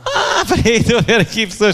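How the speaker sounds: high laughing words. Real, not acted. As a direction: laugh through the line. Laughing while spotting people.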